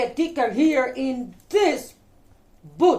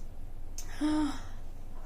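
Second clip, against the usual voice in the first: long, soft, whispery intake; shocked gasp; 2 semitones higher, 11 dB quieter than usual. Pretend. Storyteller reacts to the friends being barred, soft gasp. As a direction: gasp in suspense for the children.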